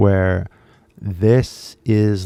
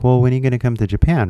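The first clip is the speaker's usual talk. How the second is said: quick imitated fan asking; fast eager question; 3 semitones higher, much faster than usual. A viewer asks the speaker to come to Japan, fast. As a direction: rattle it off eagerly. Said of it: "It's like".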